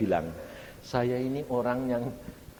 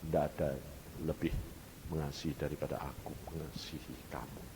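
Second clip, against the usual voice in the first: low, fading to very soft; tender divine voice; 6 semitones lower, 8 dB quieter, faster than usual. Retold or imitated. God tells the preacher none loves him more, tenderly. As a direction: let the loving assurance sink almost to a hush. God's loving words continue.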